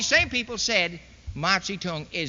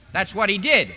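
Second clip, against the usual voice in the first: ordinary delivery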